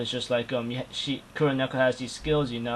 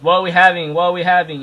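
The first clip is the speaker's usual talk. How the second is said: excited higher, louder questioning; eager; 4 semitones higher, 9 dB louder, faster than usual. Retold or imitated. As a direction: imitate someone eager to be fed. Character eager about food, retold.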